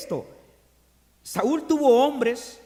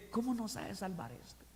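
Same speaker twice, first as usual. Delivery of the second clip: muttered aside; 14 dB quieter than usual. Retold or imitated. Preacher murmuring as the doubters in the crowd. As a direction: grumble it under your breath. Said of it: "lo dicen en voz baja".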